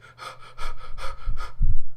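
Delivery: loud, fast, exasperated panting; rapid panicked breathing. Retold or imitated. Reviewer imitating the astronaut spinning through space, rapid panicked breathing. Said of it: "breathing, you know, rapid, like".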